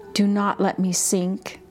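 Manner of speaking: ordinary talk, nothing marked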